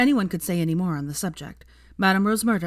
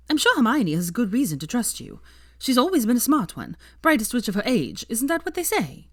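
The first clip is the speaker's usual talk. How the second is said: sweet Sophia voice; 6 semitones higher, 3 dB louder than usual. A narrator's reading. Sophia sweetly reassures Draco.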